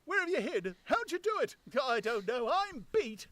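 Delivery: high, loud, bewildered tramp voice; a beaten vagrant at his wits' end; high, loud, defeated tramp; baffled tramp. Acted. Tramp voice held.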